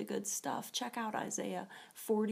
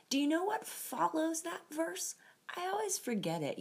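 usual speaking voice

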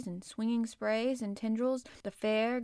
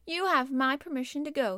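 louder, higher lordly dismissal; lordly Rajah; 6 semitones higher, 6 dB louder than usual. A narrator's reading. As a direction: dismiss them like a young Rajah. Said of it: "the Rajah waved his hand".

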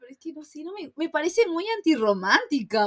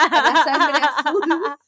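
slow, louder, through laughter; laughing joke; 3 semitones higher, 6 dB louder, much slower than usual. Real, not acted. Joking to her friend about royals, slow and laughing. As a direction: stretch it out laughing.

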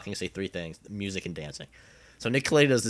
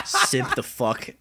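slow laughing line; 5 semitones higher, 4 dB louder, much slower than usual. Real, not acted. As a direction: laugh through the words. Excited laughing.

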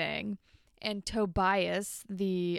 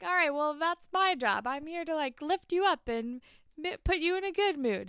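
higher, bubbly cheer-up voice; mock perky girlfriend; 7 semitones higher than usual. Jane cheering up Spinner after class, higher perky mock voice. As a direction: sell the relentless good mood, lightly mocking.

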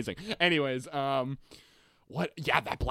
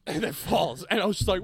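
ordinary delivery

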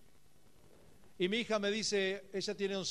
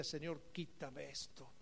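soft, slow, near-tearful prayer; mild weeping plea; 4 semitones lower, 12 dB quieter, slower than usual. Retold or imitated. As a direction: beg God softly. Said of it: "yo lloraba y le decía".

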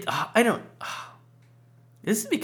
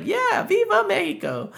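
mocking Peggy; 3 dB louder than usual. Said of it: "And Peggy's like".

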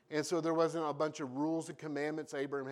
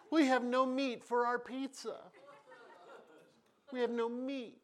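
high, mock-pitiful whine; whiny complaining Israelites; 9 semitones higher than usual.

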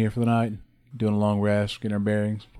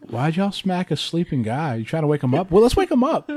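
raised-pitch bewildered character voice, building louder; confused Kraken; 6 semitones higher, 6 dB louder than usual. Acted. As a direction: play it baffled, climbing to a loud, high finish. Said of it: "Kraken looks really confused. He's like".

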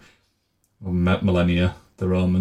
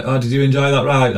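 chirpy staff question; 6 semitones higher, 4 dB louder than usual. Retold or imitated. Staff asking after the ride.

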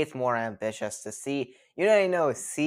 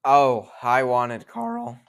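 cracking voice mid-word; 9 dB louder, much slower than usual. Real, not acted. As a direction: voice breaks on Karl. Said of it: "sorry for the voice crack".

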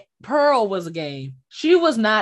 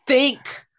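usual speaking voice

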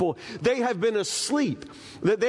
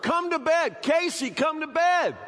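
calling out; 9 semitones higher than usual. Retold or imitated. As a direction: call her name from the bedroom. Calling wife from bedroom.

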